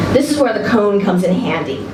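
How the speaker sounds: plain reading voice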